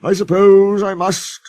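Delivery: a grudging, sulky engine; sulkily. A narrator's reading. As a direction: give in with a put-upon sigh. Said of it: "said Sir Handel sulkily".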